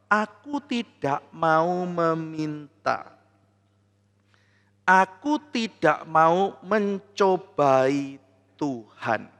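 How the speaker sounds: very slow, soft and pious; King Ahas politely declining with false holiness; polite refusal. Acted. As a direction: feign humble piety, stretching every word. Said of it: "dengan cara yang sopan".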